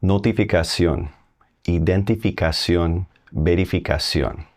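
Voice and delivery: Notificación, identificación, verificación said the Spanish way, stress on ón; Spanish pronunciation answers. Shown as a demonstration. Teacher giving learners the Spanish answers. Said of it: "We changed the T to C".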